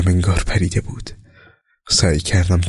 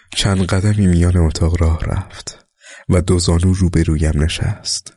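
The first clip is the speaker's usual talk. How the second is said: ordinary delivery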